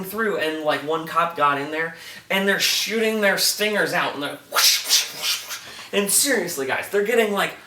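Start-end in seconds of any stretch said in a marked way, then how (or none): 4.5-5.6 breathy whoosh noises, no voice; whoosh sound. Retold or imitated. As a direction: stingers shooting out, hissed whoosh noises. Imitating stingers shooting out.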